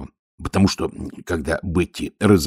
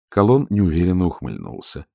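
usual speaking voice

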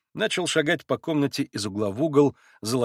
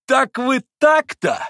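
high, loud, drawn-out protest; exclaimed reproach; 14 semitones higher, 8 dB louder, slower than usual. A narrator's reading. Mitya sees the Poles refuse his toast, loudly affronted. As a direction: throw it at them, affronted. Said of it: "воскликнул Митя".

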